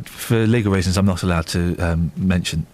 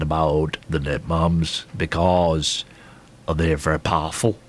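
Eamon Holmes; 8 semitones lower than usual. Retold or imitated. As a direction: lower the pitch and space out the phrases ominously. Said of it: "What did you say in your voice?".

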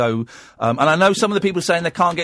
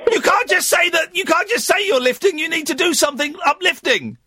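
mock exasperated. Real, not acted. Host mock-scolds caller.

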